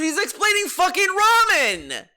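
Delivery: high, loud disbelieving yell; shouting in mock outrage at the streamer; exasperated shout. Real, not acted. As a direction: blow up at how obvious it is. Outraged he explains ramen.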